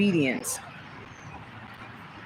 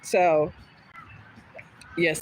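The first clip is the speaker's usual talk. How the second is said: ordinary delivery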